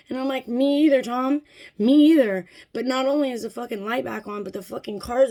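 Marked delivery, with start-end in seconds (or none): 0.5-2.5 loud, pointed echo; sarcastic agreement. Retold or imitated. Customer answering the baffled Dodge employee, sarcastic.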